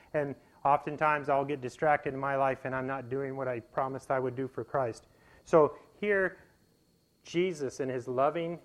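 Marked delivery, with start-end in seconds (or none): none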